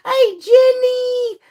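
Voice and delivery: high, drawn-out singsong hello; excited greeting. Real, not acted. Greeting a live viewer by name, excited. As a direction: call the name out long and high.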